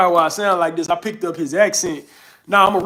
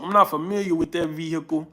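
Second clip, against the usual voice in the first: usual speaking voice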